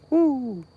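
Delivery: high whooping yell; high-pitched whoop; excited whoop. Real, not acted. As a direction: let out a high happy woo.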